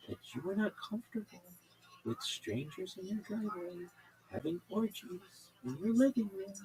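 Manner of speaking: soothing, unhurried host reassurance; smooth it all over, gently; calm radio host. Retold or imitated. Radio host calming an upset caller, soothing. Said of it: "Just the calmest way".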